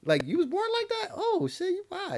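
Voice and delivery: very high-pitched, incredulous reaction; mock astonishment. Reacting to someone's look, in high mock astonishment.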